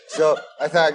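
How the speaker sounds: usual speaking voice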